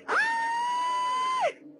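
piercing high scream; girl's scream tearing out of her; shriek. Acted. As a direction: let out a sudden terrified shriek. Possession ends in a shriek.

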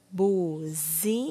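BUZINA broken slowly into bu, zi, na; syllable by syllable. Shown as a demonstration. Teacher forming the last word, slowly syllable by syllable. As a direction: sound out BUZINA with gaps between syllables. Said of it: "ordene as sílabas".

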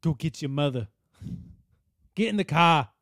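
dropped-r Boston accent; bossy Boston parent accent. Shown as a demonstration. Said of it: "that's Boston".

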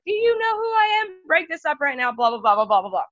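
high, hammed-up indignant RD; mocking the RD. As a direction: parody her outrage, high and fast. Mocking RD's outrage.

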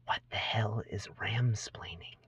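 soft unvoiced whisper. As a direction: whisper back in disbelief. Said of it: "you whisper back to him".

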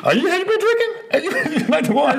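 high, laughing question; laughing tease. Real, not acted. Host teasing his wife about her drink, laughing.

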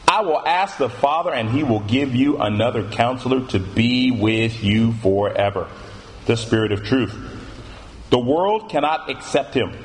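measured, solemn Bible reading with weighted pauses; scripture reading. Acted. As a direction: read the verses out deliberately, phrase by phrase. Reads scripture aloud.

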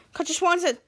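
ordinary talk

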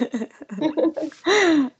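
high burst of laughter; hearty laugh. Real, not acted. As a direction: laugh out freely. Laughing at the fans' comments.

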